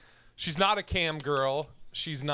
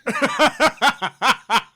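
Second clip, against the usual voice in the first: loud laugh; 9 semitones higher, 11 dB louder than usual. Real, not acted. Laughing at own joke.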